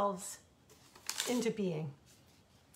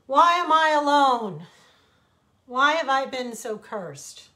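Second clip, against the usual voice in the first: much higher and louder, a wailing lament; anguished outcry; 5 semitones higher, 13 dB louder than usual. A narrator's reading. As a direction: cry out against your fate. Desert laments being cursed.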